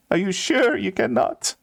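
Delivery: high, tearful last appeal; wounded, high-pitched begging; pained pleading. Acted. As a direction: ask in acted anguish, clinging to hope. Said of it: "pain in his face".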